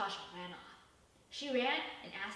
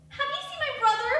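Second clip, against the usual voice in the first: much higher and loud; urgent question; 9 dB louder than usual. Acted. Sasha asks Shark about her brother, high urgent plea. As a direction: thrust the question at him. Waving missing poster at shark.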